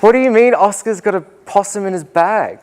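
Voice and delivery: you can't believe what you heard; incredulous. Retold or imitated. Teacher incredulous retelling.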